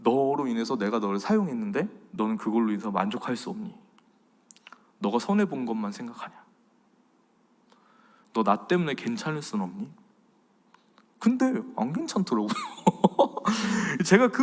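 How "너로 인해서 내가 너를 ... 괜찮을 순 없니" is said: soft, lower, tender questioning; God gently asking. Retold or imitated. God tenderly questioning the preacher, soft. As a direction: ask gently with long pauses. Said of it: "하나님 딱 집어서 뭐라고 하시냐면".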